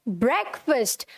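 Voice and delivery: ब्रेकफास्ट said "BREK-fust"; short vowels in both halves of ब्रेकफास्ट; correct pronunciation. Shown as a demonstration. Teacher modelling for students, ब्रेकफास्ट as "BREK-fust". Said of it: "आज से ये pronunciation है".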